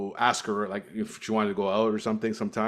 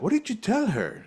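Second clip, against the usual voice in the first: much higher, in a put-on Romanian accent; the father demanding answers, mock Romanian accent; 10 semitones higher than usual. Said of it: "I don't know if that's the accent".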